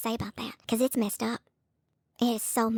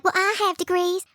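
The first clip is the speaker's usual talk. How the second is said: mocking quote; 6 semitones higher, 4 dB louder than usual. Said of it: "and you're like".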